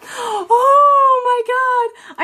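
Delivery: very high, drawn-out laughing wail; gasping out the words through tears of laughter; laugh-crying. Real, not acted. Telling viewers the story, crying with laughter. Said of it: "I cannot tell this story without dying".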